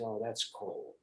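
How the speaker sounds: energy dropped to a quiet flat aside; dead stop, deadpan. Retold or imitated. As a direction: stop dead and mutter it softly. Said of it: "you stop dead and say".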